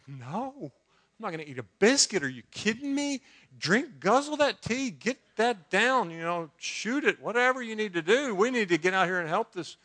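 urgent near-shout, brushing off the biscuit; frantic panicked protest. Retold or imitated. Preacher reliving his panic at the calm pastor, loud and frantic. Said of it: "I'm just panicking".

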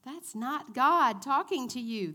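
ordinary delivery

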